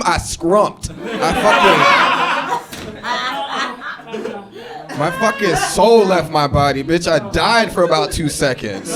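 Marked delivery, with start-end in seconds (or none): 0.0-6.8 mock scream. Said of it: "the scream".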